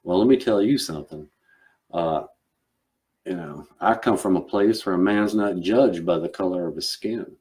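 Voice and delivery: earnest Southern gentleman making a stand; Southern accent. Acted. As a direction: declare it in an old-world Southern accent. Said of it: "you don't really think of somebody going".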